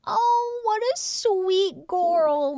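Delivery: high, slow doting coo; drawn-out adoring coo. Real, not acted. Cooing over lunch from Minnie.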